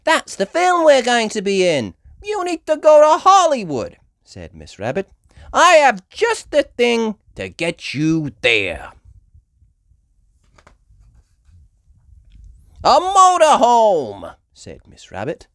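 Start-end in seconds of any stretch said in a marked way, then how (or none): 0.0-4.0 high, loud New York voice; wisecracking driver; New York cabbie. Acted. Miss Rabbit voice. 5.5-14.4 high, loud New York voice; wisecracking driver; New York cabbie. Acted. Miss Rabbit voice.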